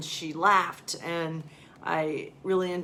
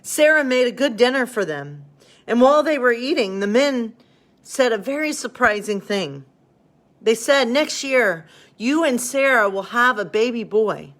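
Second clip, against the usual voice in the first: higher, louder storybook narration; story reading; 4 semitones higher, 7 dB louder than usual. A narrator's reading. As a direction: read it like a children's bedtime story. Reads story, angels' message.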